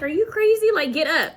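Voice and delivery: high, loud disbelief; mock shocked girlfriend; lightly voiced incredulous protest. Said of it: "And she's like".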